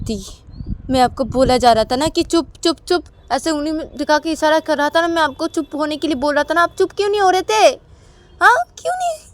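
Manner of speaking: high, loud scolding; angry complaint. Acted. Brother scolding his sister, high loud anger. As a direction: blame her, voice climbing.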